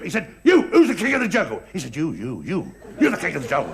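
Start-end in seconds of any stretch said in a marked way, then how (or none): 0.4-1.6 higher, forceful demand from a bullying lion; angry lion challenge. Acted. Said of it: "He was really mad". 1.9-3.7 low, placating reply to a bully; cowed little chimp. Acted. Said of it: "he saw a little chimp".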